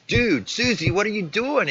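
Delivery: high, loud mock outrage; indignant confrontation. Boyfriend confronts Susie on video chat, indignant.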